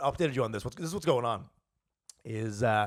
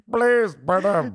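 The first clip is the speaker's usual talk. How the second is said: put-on loud, slow, higher African accent; 5 semitones higher, 7 dB louder, much slower than usual. Co-host trying his own impression, hammed-up African accent. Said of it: "do an African accent like this".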